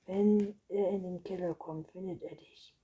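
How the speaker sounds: loud burst at the start, then easing; a touch of alarm, read aloud; urgent warning. A narrator's reading. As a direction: warn a friend emphatically. Boy urgently warns the vampire.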